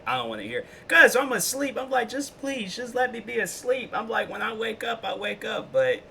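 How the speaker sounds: high, whiny sleepy pleading. Real, not acted. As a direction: whine like someone begging to sleep. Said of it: "after one A M I can't speak English".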